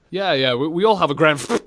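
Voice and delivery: ordinary talk, nothing marked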